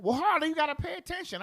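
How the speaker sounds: high, loud teacher scolding; imitating teacher. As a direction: mimic an irritated teacher calling out a pupil. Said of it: "And they be like".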